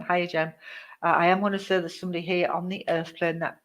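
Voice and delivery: usual speaking voice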